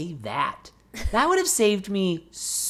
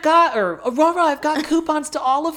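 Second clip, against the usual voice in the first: high and louder, mock eager invite; pushy chirpy offer, pitched up; bubbly Christine impression; 10 semitones higher, 4 dB louder than usual. Mimicking Christine offering Olive Garden coupons, high and eager.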